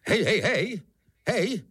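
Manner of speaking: high, loud calls to rouse someone; naléhavé volání. Acted. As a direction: call out urgently, high and insistent. Kubát křísí bezvládnou Barku. (vysoké, naléhavé pokřikování)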